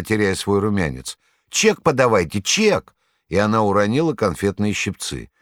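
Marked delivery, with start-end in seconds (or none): 1.5-2.9 high, frantic demand, last word shrill-high; panicked demand. A narrator's reading. Saleswoman to the fruit-eater, panicked demand. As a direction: insist in rising panic.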